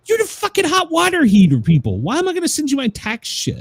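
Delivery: a high-pitched outraged outburst at the heater company; exasperated rant. Real, not acted. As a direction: rant at them, outraged and incredulous. Ranting at the water heater people.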